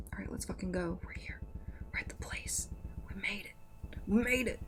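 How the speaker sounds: excited whisper on arrival. Real, not acted. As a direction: hush it, thrilled. Arriving at the haunted station.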